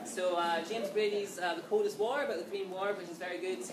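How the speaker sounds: ordinary delivery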